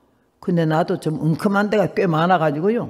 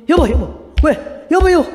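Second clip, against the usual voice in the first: husband waking wife in alarm; 7 semitones higher, 4 dB louder than usual. Retold or imitated. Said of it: "막 깨우는 거야".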